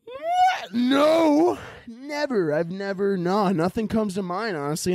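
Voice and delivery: high, squeaky feigned surprise; mock innocence. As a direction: play dumb, overdoing the denial. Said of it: "And I'm gonna have to be like".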